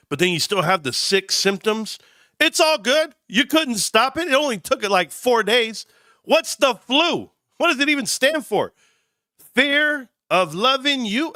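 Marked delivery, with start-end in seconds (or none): 2.4-11.3 giddy. Real, not acted. Giddy joking while sick.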